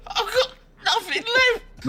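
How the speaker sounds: very high squeaky lament; chipmunk voice. Acted. Soundboard clip again.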